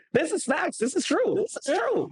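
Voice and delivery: high, eager "this is true"; mock insistence. To the host, playfully insisting it's true, high and eager. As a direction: swear it's true, pitch way up.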